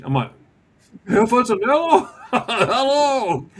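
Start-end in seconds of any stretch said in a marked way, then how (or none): 1.1-3.5 high, laughing disbelief at meeting him; starstruck surprise. Real, not acted. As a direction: gasp his name, then laugh hello. Recognising his hero suddenly.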